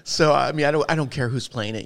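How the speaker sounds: usual speaking voice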